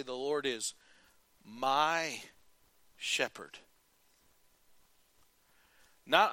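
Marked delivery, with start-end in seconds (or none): 0.0-3.6 sincere low confession of faith; low sincere declaration. Shown as a demonstration. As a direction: say it slowly with pauses. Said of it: "Can you say".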